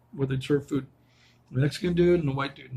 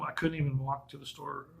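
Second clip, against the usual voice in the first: usual speaking voice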